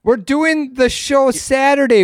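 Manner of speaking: insistent, voice pushed up high; high-pitched protest. Real, not acted. Co-host corrects the others on the schedule, high insistent protest. Arguing over the weekend schedule.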